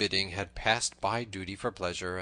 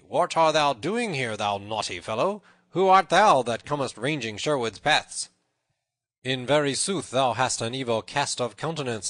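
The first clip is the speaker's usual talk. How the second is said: loud, raised accusing voice; bold challenge; 5 semitones higher, 9 dB louder than usual. A narrator's reading. Little John accuses the tanner of poaching, boldly challenging. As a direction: confront a suspected poacher head-on. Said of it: "put a bold face upon the matter".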